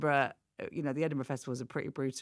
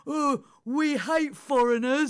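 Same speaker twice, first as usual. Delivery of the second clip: higher, louder, slow jeering shout; mock drunk heckle; 9 semitones higher, 7 dB louder, slower than usual. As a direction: heckle like a drunk old man. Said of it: "this man shouted out".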